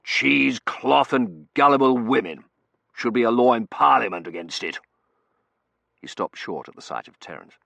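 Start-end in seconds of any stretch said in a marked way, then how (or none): 0.0-4.8 loud, emphatic rant; indignant colonel laying down the law; blustering colonel. A narrator's reading. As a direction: rail against it in a raised voice. Colonel ranting against séances.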